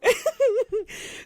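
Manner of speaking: squealing laugh breaking up the story; high burst of laughter. Real, not acted. Laughing at her date story to a friend, a high squeal.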